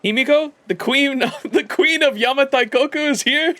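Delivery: high, laughing astonished squeal; excited disbelief. Acted. Reading the game line as an astonished fan, high and laughing. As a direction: gasp it out, delighted she is here.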